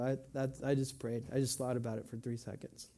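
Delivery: low, soft, offhand mumble of an excuse; quick, disengaged prayer. Retold or imitated. Churchgoer excusing a three-second prayer, offhand and unengaged. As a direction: imitate someone tossing off a half-hearted prayer. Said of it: "say a really quick prayer without really engaging".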